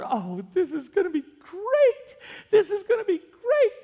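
very high, squealing delight; overjoyed, almost crying with glee; dad gushing as the boat turns the corner, squealing; giddy excitement. Retold or imitated. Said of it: "and I'm going".

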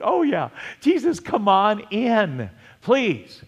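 high, drawn-out eager plea; eager welcome. Acted. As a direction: wave someone in with a high, stretched call. Acts out welcoming Jesus aboard.

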